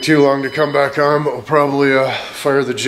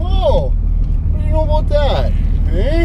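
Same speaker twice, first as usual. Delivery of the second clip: sarcastic, swooping up into falsetto; mocking high voice; 9 semitones higher than usual. Vlogger remarking on the two-lane road, high-pitched mockery. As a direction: jeer in a pitched-up mock-amazed voice.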